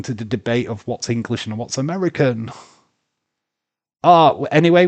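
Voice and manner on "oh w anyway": loud, higher outburst to cut in; loud exclamation. Real, not acted. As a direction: blurt it out loud to win the point. Cutting off the argument.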